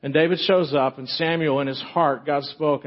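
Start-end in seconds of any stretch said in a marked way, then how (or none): none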